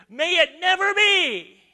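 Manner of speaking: emphatic denial. Retold or imitated. Preacher voicing Paul's emphatic refusal, loud. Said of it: "and he said".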